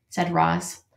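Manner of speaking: usual speaking voice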